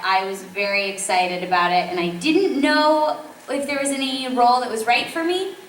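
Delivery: ordinary delivery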